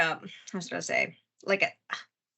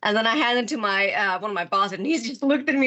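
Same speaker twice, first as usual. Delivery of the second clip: normal talking voice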